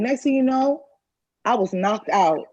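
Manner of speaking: ordinary talk, nothing marked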